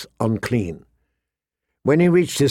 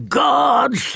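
furious oath; 13 semitones higher than usual. Acted. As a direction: explode with barely contained fury. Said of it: "the king swore, the word exploding".

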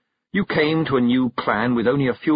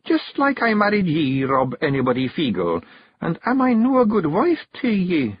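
higher Scottish wifely challenge; pointed question to husband; Kelda Jeanie; 8 semitones higher than usual. Acted. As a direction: Jeanie cornering Rob, higher.

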